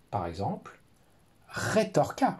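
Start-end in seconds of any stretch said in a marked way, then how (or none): 1.5-2.4 suggested word. Shown as a demonstration. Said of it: "on pourrait dire".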